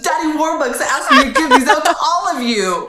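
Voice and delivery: put-on very high, laughing child voice; Annie voice. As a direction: chirp it like a cheerful orphan. Plays Annie handing out presents.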